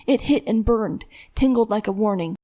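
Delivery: usual speaking voice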